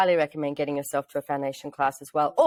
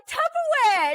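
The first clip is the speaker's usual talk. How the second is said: very high squeal of mock delight; over-the-top excited; slower than usual. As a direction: imitate someone thrilled beyond reason. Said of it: "he was excited".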